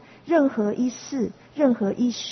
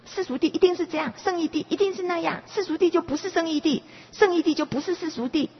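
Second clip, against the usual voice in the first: fast, higher, mock-rigid rattling off; mimicking rigid view; 7 semitones higher, faster than usual. Teacher imitates students' rigid view, quick and higher. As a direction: rattle it off like a stubborn literalist. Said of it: "认为".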